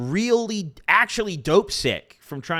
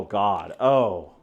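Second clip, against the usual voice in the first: ordinary talk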